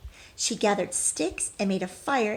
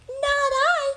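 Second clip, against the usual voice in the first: tiny mouse squeak, much higher and louder; squeaky mouse; 5 dB louder than usual. Acted. Mouse's refusal in the story.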